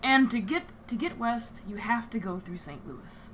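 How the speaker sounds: calm, unhurried storyteller narration; game master scene setting. Acted. Game master setting the scene on the train, calm narration. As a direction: set the scene for the players.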